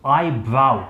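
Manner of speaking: ब्राउ with au, as in now; correct eyebrow. Shown as a demonstration. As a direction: say आई ब्राउ clearly. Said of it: "आई ब्रो नहीं है". ("ब्राउ" आउ के साथ)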